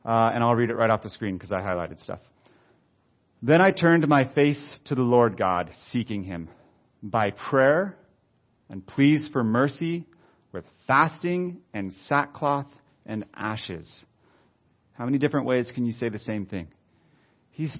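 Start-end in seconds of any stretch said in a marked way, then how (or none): 3.4-14.0 slow, lifted, deliberate Bible reading; solemn pulpit reading; scripture reading. Acted. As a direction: read the prayer aloud, phrase by phrase. Reads the prayer aloud.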